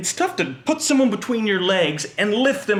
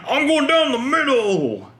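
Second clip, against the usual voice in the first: high, loud, slow battle call; butch gym teacher; 8 semitones higher, 3 dB louder than usual. Retold or imitated. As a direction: charge in like a tough coach. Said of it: "gym teacher would always be like".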